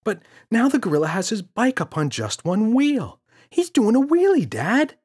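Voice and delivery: put-on very high, loud child voice; excited child. Boy telling father the gorilla dances, excited. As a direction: gush it like a thrilled kid.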